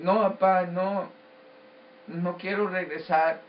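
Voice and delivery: put-on a child's insistent no to papá; retold protest. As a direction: protest insistently, refusing to go back. Pleading with grandfather not to return.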